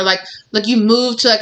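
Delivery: ordinary talk, nothing marked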